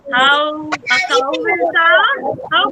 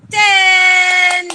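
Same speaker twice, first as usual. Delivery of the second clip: final count shout; 4 dB louder than usual. Real, not acted. Counting jumps, final count called out.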